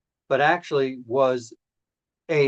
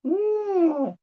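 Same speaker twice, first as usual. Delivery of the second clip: cat as a backhoe; much slower than usual. Acted. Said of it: "a cat pretending to be a backhoe".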